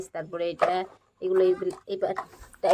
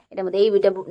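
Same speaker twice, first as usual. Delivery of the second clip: ordinary talk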